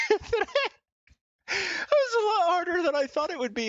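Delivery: out of breath after ten burpees, exclaiming; winded exclaim. Real, not acted. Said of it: "I'm out of breath".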